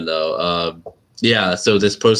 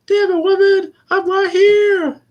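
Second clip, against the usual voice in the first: very high, loud mock protest; exasperated husband skit; 6 dB louder, slower than usual. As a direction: protest in a silly high voice, stretching the end. Husband answers in referenced skit.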